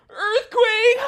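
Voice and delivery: shrieked high and loud, with a gasp; hammed-up earthquake scare; mock panic. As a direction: scream like the ground is shaking.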